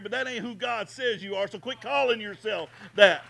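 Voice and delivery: loud pulpit command; booming preaching. Acted. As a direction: bark it at the congregation. Preaching at full force.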